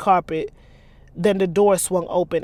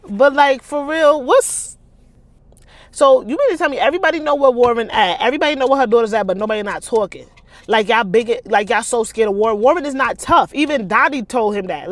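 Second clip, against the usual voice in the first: loud, high, fast indignant rant; heated rant; 4 semitones higher, 7 dB louder than usual. Real, not acted. Reader ranting after the chapter, loud and fired up. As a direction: vent about the scared characters.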